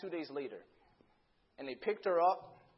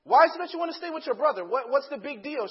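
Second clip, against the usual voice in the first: fast, high, loud acted demand; heated exasperation; 7 semitones higher, 10 dB louder than usual. Dad pressing daughter about her brother, rapid exasperated outburst. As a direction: rush the question out, worked up.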